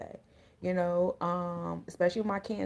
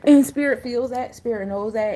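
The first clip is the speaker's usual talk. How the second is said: louder, higher punch on spirit feels that; forceful emphasis; 4 semitones higher, 9 dB louder than usual. Real, not acted. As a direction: drive the point home, sudden and loud. Reader stressing spirit's power.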